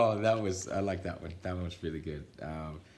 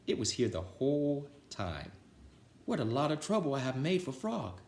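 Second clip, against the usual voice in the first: higher, lightly voiced sorry toad; Toad sheepish; 9 semitones higher, 3 dB louder than usual. Toad admitting his button was home all along, lightly voiced. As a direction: realize you caused all the fuss. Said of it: "said Toad".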